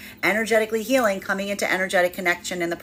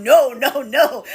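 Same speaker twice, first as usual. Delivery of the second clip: louder, laughing "no, no"; laughing mock refusal; 5 semitones higher, 6 dB louder, slower than usual. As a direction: refuse while giggling. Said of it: "I can see you going".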